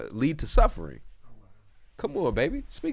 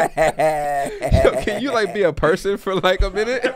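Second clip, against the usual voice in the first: high, loud plea through laughter; laughing exasperation; 7 semitones higher, 8 dB louder, slower than usual. Real, not acted. Host begging friend to talk like a person, laughing exasperation. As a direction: beg him, cracking up.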